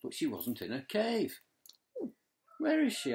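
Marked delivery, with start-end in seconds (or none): 2.6-3.1 high, loud worried cry; anxious question. Acted. Reading Dolphin's worried question to the grandchildren, high and anxious. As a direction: ask it with rising alarm for a lost friend. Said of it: "asked Dolphin anxiously".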